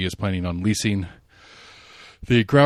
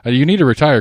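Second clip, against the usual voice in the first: louder, higher push; playful urging; 6 semitones higher, 10 dB louder, faster than usual. Real, not acted. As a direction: call out to him playfully.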